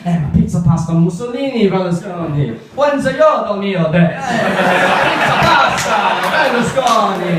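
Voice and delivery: loud, high mock Italian accent shouting food words. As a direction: ham up an Italian street cry of clichés. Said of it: "the Italian conversation that you hear".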